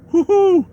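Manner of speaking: high falsetto woo-hoo; mock cheer. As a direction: whoop up high like a mock fan. Mock cheer for boy band.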